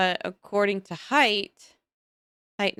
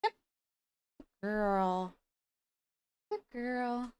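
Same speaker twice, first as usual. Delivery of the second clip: praising a dog; 7 semitones higher, 8 dB quieter, much slower than usual. Real, not acted.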